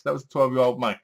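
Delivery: usual speaking voice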